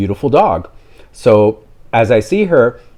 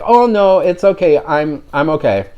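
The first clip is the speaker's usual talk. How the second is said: higher, quick polite no thanks; waving off the offer, sweetly higher; polite brush-off; 6 semitones higher than usual. Retold or imitated. Declining to pet a stranger's dog, politely higher. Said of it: "And I was like".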